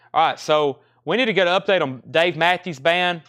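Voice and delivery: ordinary talk, nothing marked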